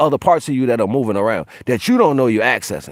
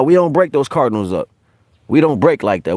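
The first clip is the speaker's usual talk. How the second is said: ordinary talk, nothing marked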